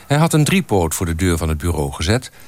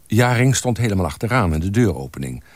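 usual speaking voice

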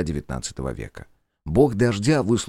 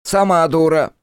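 angry shout; 10 semitones higher, 8 dB louder than usual. Acted. Eric yells back.

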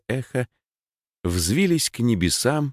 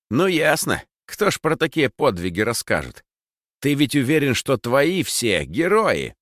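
mocking taunt; 7 semitones higher than usual. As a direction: needle him with scorn. Max taunts Lis.

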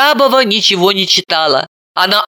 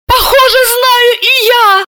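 yelling; 4 dB louder than usual. A narrator's reading. Serafima yelling that she knows too, high and loud. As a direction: wail it out. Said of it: "завопила она".

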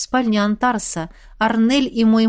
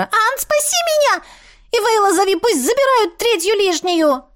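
very high, screeching mop voice; shrieking mop; 11 semitones higher than usual. A narrator's reading. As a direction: screech like a squashed creature.

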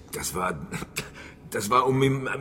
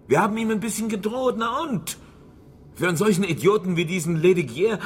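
indignant; 7 semitones higher than usual. Acted. Shopkeeper scoffs at mayor's suspicion.

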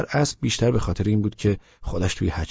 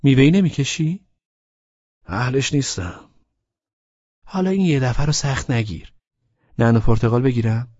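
put-on young woman's voice, pitched higher, coaxing; coaxing Laleh; 7 semitones higher, 5 dB louder than usual. A narrator's reading. Laleh coaxing Abbas to try a fruit hookah, pitched up and persuasive. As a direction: talk him into trying the fruit hookah, sweetly insistent. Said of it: "لاله گفت".

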